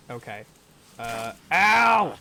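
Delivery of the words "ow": sudden loud yelp; sharp "ow" of alarm; pained yelp. Real, not acted. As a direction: cry out as if just struck. Yelps when hit in game.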